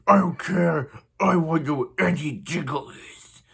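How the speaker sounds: loud, put-on angry Batman growl; Batman impression. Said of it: "very good with Batman impression".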